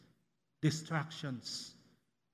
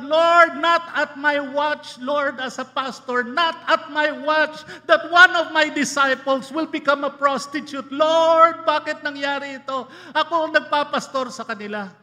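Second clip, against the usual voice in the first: anguished cry to God; 8 semitones higher, 12 dB louder than usual. Retold or imitated. Retold anguished complaint to God.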